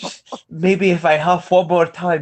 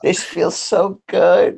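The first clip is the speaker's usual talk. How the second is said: mock junkie bliss; 5 semitones higher, slower than usual. Mock junkie sex fantasy.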